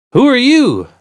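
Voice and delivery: high, loud challenge; sharp retort. Acted. Puzzled walker answers a challenging stranger, loud retort. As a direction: fire it back at him loudly. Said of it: "I retorted".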